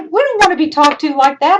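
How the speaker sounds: high, loud and indignant. Real, not acted. Teacher protesting rude student emails, high and indignant. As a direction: protest being talked to like that. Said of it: "indignant about rude emails".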